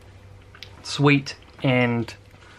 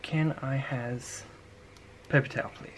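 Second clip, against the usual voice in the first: hushed; 9 dB quieter than usual. Real, not acted. Still quiet near sleeping baby.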